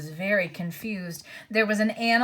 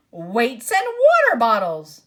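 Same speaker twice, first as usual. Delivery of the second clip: angry dwarf; 7 dB louder than usual. A narrator's reading. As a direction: snap it out crossly. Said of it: "came an angry voice".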